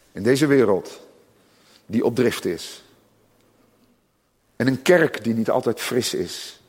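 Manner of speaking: ordinary talk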